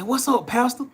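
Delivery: high, bright mock greeting. Imitating the old man's greeting to the young man. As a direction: call out a cheerful hello to the pastor.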